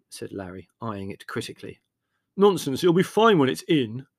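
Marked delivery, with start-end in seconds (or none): 2.4-4.1 loud, higher dismissive retort. A narrator's reading. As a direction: brush the objection aside forcefully. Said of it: "retorted Leslie".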